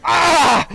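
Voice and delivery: sharp pained yell; hit hard crying out. Retold or imitated. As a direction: cry out as the nose breaks. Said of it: "he yells".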